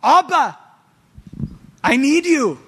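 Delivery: desperate cry of a believer to God. Retold or imitated. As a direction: plead like a child needing his daddy. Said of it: "We cry out".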